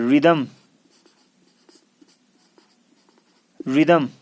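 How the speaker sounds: रिदम as "ri-dum", no H after R; each रिदम said separately as a model; silent H. Shown as a demonstration. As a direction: open रिदम on a plain R. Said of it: "आर एच silent है यहाँ".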